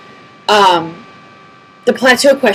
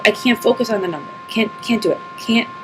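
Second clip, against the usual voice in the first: softer, holding back tears; real emotion welling up, quieter; getting teary; 7 dB quieter, faster than usual. Real, not acted. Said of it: "I'm gonna get teary about this".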